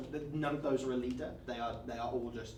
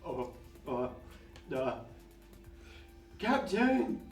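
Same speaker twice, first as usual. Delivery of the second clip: flustered guard calls out, high; stammer, then cry out for the captain; panicked guard call; 4 semitones higher, slower than usual. Acted.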